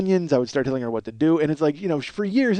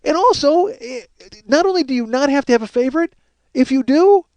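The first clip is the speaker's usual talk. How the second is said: fed-up raised rant ending in a laugh; high exasperated rant; 11 semitones higher than usual. Real, not acted. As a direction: pile on the disbelief. Ranting at wife's lie.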